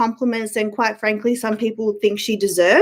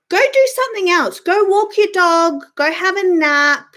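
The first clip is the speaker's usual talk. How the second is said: dismissive; 6 semitones higher than usual. Real, not acted. Dismissing commenters.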